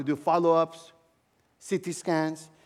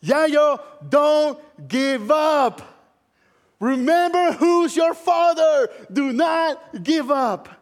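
higher, louder, slow pleading pastor voice; urgent pastor; 8 semitones higher, 6 dB louder, much slower than usual. Retold or imitated. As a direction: plead like a friend calling you back from the edge. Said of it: "pastor friend of mine that said".

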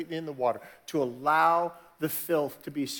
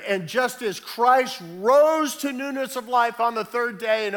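much higher and louder, building to a fervent pitch; preaching at full force; 7 semitones higher, 5 dB louder than usual. Acted. Pastor driving home baptism to the congregation, full preaching force. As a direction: preach it hard, climbing with every line.

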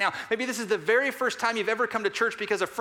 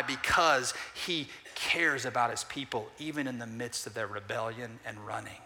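soft reassuring answer; 6 semitones lower, 5 dB quieter than usual. Acted. Drops to soft slow answer.

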